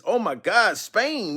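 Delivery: ordinary delivery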